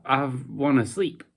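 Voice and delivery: ordinary talk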